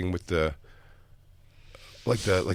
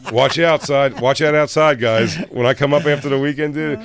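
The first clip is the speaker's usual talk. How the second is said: loud, high-pitched acted-out chatter; put-on loud, raised voice; mock hearty holler; 6 semitones higher, 7 dB louder than usual. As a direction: holler it like an over-friendly buddy act. Acting out someone's hearty greeting, then protest.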